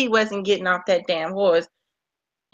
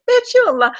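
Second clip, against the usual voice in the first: mock retort; 12 semitones higher, 3 dB louder than usual.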